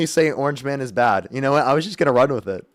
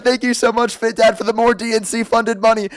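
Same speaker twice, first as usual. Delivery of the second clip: put-on voice; 4 semitones higher than usual. Streamer thanking donors mid-argument, higher put-on voice. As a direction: ham up the donation thanks. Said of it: "I'm done with the voice".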